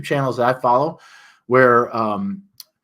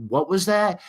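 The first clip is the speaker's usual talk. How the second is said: confused what; 9 semitones higher than usual. Retold or imitated. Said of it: "backing up the audio".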